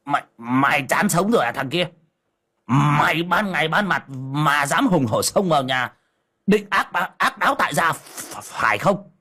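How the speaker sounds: blustering threat. Acted. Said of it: "hắng giọng".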